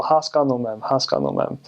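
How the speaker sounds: Haskanumem with a full "ah" in the second syllable; even syllables: "has-kahn-oo-mem"; good Armenian accent. Shown as a demonstration. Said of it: "you have the ah on that second syllable".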